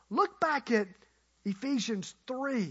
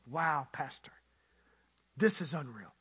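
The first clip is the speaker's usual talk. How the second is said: low, soft, understated amazement; awed guest; 8 semitones lower, 5 dB quieter than usual. Retold or imitated. Guest reacts to the pastor's lavish welcome, quietly awed. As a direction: drop right down, as if quietly overwhelmed. Overwhelmed guest shown the steak.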